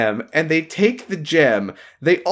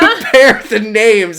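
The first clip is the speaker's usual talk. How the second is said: laughing; 6 semitones higher, 7 dB louder, slower than usual. Real, not acted. Laughing while retelling.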